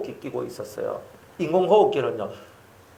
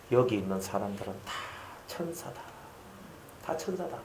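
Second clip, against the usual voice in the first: frail father's praise; 6 semitones lower, 13 dB quieter than usual. Retold or imitated. Dying father telling daughter the church folk are angels, faintly. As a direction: breathe it faintly like a dying old man. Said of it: "저희 아버지가 하는 말이".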